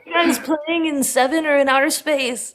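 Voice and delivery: high, loud acted-out amazement; imitating an awestruck audience member; amazed fan. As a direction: shout it like a blown-away concertgoer.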